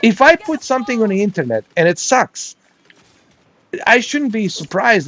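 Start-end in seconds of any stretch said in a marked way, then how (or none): none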